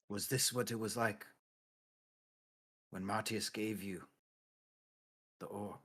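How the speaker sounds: hushed, halting question close to the ear; mock whisper. Player's character asks Strabo in his embrace, hushed near-whisper. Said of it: "So whispering to him, I say".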